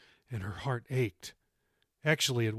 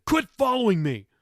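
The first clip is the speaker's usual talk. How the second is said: loud, high shout back; teen shouting at grandmother following him; calling over shoulder; 9 semitones higher, 8 dB louder than usual. A narrator's reading. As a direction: yell it over your shoulder. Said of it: "he called over his shoulder".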